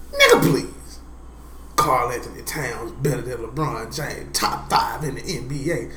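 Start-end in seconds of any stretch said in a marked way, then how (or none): 0.1-1.0 high, loud scoff; scoffing dismissal. Real, not acted. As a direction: brush it off with a scoff. Scoffing retort to friend.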